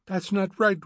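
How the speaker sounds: high-pitched wail of protest. A narrator's reading. Mrs. Bowles objects to hearing poetry, wailing. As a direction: wail it in distress. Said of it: "wailed Missus Bowles".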